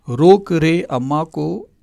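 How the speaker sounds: "रोक रे अम्मा को" slowly and clearly, each word drawn out; slow mnemonic. Shown as a demonstration. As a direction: spell out the memory trick slowly, like a teacher. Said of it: "mnemonic said slowly". (धीमे, साफ़ उच्चारण में याद करने की trick)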